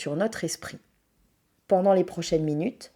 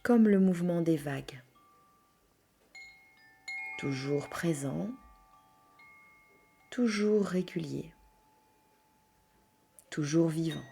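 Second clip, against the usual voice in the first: soft, hushed yoga nidra voice with long pauses; guided meditation; 2 semitones lower, 10 dB quieter than usual. Acted. Leads a yoga nidra relaxation.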